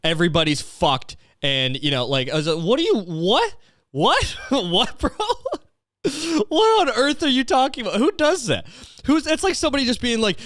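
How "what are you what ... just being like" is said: very high, incredulous questioning; squeaky-high comic incredulity; mind-blown disbelief. Retold or imitated. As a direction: act out utter bafflement with a laugh breaking in. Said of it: "this blew my mind".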